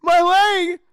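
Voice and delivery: high, very loud panicked wail; imitating hysterical horror; horrified reaction. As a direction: scream it, drawing out the words. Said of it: "Justin's fucking reaction is great".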